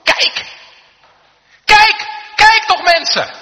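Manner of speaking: high, full-force urgent shout; calling out. Acted. Moses among the dying people, shouting urgently. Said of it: "ik hoor hem roepen".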